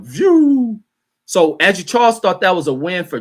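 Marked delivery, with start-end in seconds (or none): 0.0-0.8 high drawn-out zooming whoosh; rising whoosh noise. Acted. Host imitates gas prices taking off, high whoosh. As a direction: imitate something shooting upward. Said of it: "Gas went".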